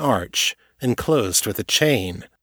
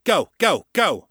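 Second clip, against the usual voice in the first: barked order; 9 semitones higher than usual. Acted. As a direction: bark it into the radio. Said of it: "Jaguar barked into the comm".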